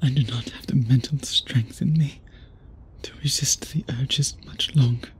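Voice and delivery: strained, hushed whisper. Acted. Vampire whispering his struggle against the urge. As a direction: whisper it as if barely holding back.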